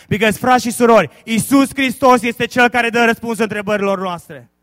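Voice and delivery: very loud, fast Romanian proclamation; preaching at full force. Acted. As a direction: thunder the answer in Romanian.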